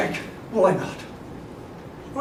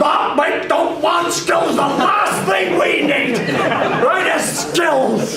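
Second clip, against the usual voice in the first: dismissive coach; 3 semitones higher, slower than usual. Retold or imitated. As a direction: scoff at the skills like an old-school coach. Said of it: "I got skills".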